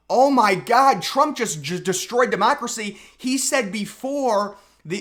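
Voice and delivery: hammed-up sarcastic indignation; mock outrage. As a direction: parody a horrified liberal.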